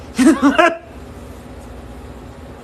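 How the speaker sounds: high burst of laughter trailing into breath; amused laugh. Real, not acted. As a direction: laugh out high, then run out of air. Amused by her own queen voicing.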